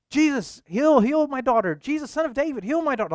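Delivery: high, loud pleading cry to Jesus; desperate yelling. Acted. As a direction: cry out for a sick daughter's healing. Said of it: "yelling at the top of her lungs".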